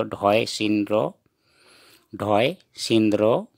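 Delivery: ढॉय सिनरो read slowly, each sound drawn out; slow reading aloud. Shown as a demonstration. Teacher rereading the Assamese letters slowly for viewers. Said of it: "मैं फिर से पढ़ के बता रहा हूँ".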